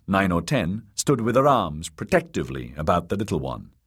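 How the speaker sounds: ordinary delivery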